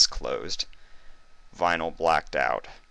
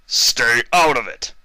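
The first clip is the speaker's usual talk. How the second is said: louder, slow, venomous command; spat threat; 7 semitones higher, 8 dB louder than usual. A narrator's reading. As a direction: spit the words at her. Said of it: "he spat at Vinyl".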